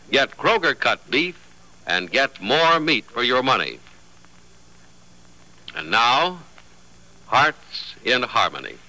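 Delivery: ordinary talk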